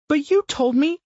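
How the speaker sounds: put-on high little girl's plea; a hurt young daughter; child's voice. A narrator's reading. Daughter reminds her father, high hurt child voice.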